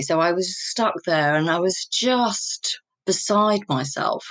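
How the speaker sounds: ordinary delivery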